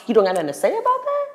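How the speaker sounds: high, fast pressing question; indignant. Retold or imitated. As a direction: press her, rushed and outraged.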